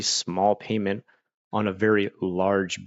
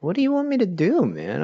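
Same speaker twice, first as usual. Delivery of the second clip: high, fast, put-upon plea; much higher and quicker mock complaint; exasperated whine; 11 semitones higher than usual. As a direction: imitate a caller throwing up his hands. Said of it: "they'd be like".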